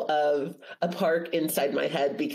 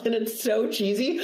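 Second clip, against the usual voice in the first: usual speaking voice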